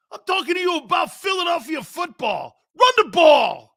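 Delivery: high, loud mock protester shout. Philly fan yells at the news reporter, high and loud. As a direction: blow up at the reporter.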